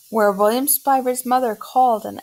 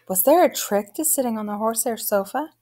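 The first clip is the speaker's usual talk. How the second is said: ordinary delivery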